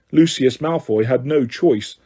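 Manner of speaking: ordinary delivery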